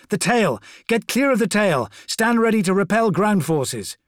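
high, loud urgent warning; shouted battle orders. A narrator's reading. Corvo warning men of the lashing tail, shouted orders.

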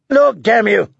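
high, sharp exclamation; urgent angry command. Acted. Warrior forcing the girl to see the burning camp, urgent and angry. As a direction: snap the order out, pitched well up. Hound orders girl to look.

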